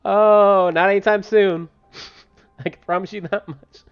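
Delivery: loud laugh, then high, loud laughing reply. Real, not acted. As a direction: cackle then answer loudly. Laughing reaction to chat.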